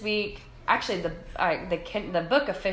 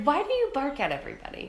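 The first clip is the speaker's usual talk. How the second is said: scolding dog; 9 semitones higher, 4 dB louder, faster than usual. Retold or imitated.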